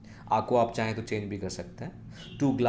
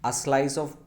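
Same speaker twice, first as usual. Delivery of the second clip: ordinary talk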